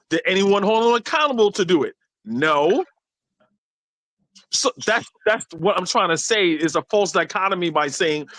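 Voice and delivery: ordinary delivery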